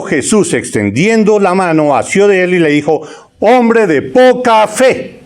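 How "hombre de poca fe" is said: a hint of Jesus' rebuke, higher and slow; drawn-out reproach, leaning on poca; Jesus rebuking. Preacher voicing Jesus scolding sinking Peter, slow high rebuke.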